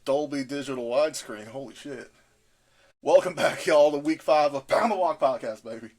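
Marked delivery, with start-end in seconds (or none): 3.0-5.9 hyped intro. Acted. Big podcast intro, loud.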